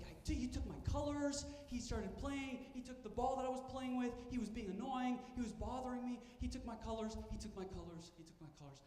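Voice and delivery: put-on high, rushed, complaining kid voice, fading away at the end; defensive child. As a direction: whine it like an upset seven-year-old, then trail off. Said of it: "he was like".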